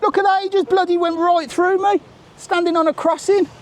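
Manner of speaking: high, exasperated complaint at a cyclist; indignant outburst. Real, not acted. Cyclist nearly hits him.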